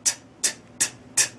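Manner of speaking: "ت" as a dry unvoiced hi-hat tick; breathy tick of the tongue, "ت" like a cymbal; whispered hi-hat. Shown as a demonstration. Teacher demonstrating the hi-hat to students in whispered ticks. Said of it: "فقط با حرف تی انگلیسی بگه".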